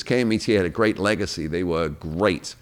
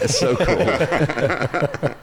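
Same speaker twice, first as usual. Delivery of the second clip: laughing; 8 semitones higher, much slower than usual. Real, not acted. Guest flattered by the intro.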